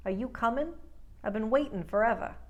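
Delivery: "coming" and "waiting" ending in plain N, New York style; dropped G. Shown as a demonstration. Coach giving examples for New York, dropped G in "coming" and "waiting". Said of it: "get rid of the G".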